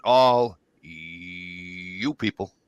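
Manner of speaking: very slow, stretched "All you people" while circling the room; drawn-out pointing. Retold or imitated. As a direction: drag out "All you people" slowly, like sweeping everyone in. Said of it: "and I went like this".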